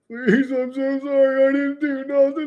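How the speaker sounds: high, slow, whimpering mock sob; mock crying plea. Fired man crying to the boss on the phone, high slow mock sob. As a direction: imitate a man begging through tears. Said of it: "like, crying, crying on the phone".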